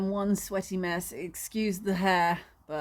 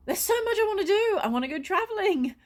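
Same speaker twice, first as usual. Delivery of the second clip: higher and quick, spilling over; dreaming of new plans, excited rush; 9 semitones higher, faster than usual. Real, not acted. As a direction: gush about everything ahead. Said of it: "excited about future plans".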